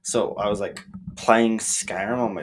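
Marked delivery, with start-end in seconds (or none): none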